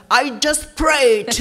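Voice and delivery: slow, loud, mock proud announcement; mocking a showy believer; mock shout. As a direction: drag it out and call it out like a show-off. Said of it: "and don't shout".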